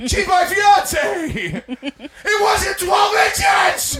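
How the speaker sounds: high, loud, slow protest through laughter; laughing outburst; laughing shout. Real, not acted. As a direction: blurt it out defensively while cracking up.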